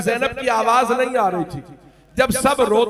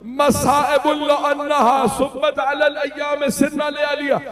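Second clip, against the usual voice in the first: weeping lament; 7 semitones higher than usual. A narrator's reading. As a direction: cry it out in a raised, breaking voice. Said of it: "बीबी ने रो के कहा". (रोते हुए ऊँची आवाज़ में मातम)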